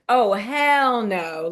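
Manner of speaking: slow, drawn-out "hell no"; flat refusal. Retold or imitated. Her brain refusing the move, slow drawn-out refusal. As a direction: stretch out the refusal. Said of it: "So my brain went".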